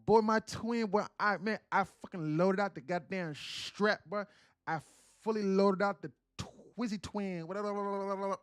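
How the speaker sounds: loud, high Atlanta street slang voice; Atlanta lingo impression. Streamer mocking how people in Atlanta talk, loud and high Atlanta lingo. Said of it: "The Atlanta lingo is like".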